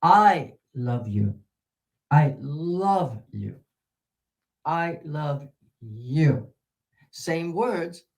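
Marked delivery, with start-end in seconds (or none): 0.0-1.4 "I" loud and long, then "love you" lower; stress on I. Shown as a demonstration. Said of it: "completely different nuance". 2.1-3.6 "love" held long between short "I" and "you"; stress on love. Shown as a demonstration. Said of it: "completely different nuance". 4.6-6.5 slow, with "you" set apart and drawn out; stress on you. Shown as a demonstration. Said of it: "completely different nuance".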